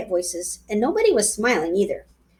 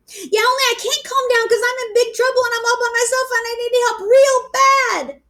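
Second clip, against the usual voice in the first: pleading; 11 semitones higher, 3 dB louder than usual. A narrator's reading. Pleading with the phone operator.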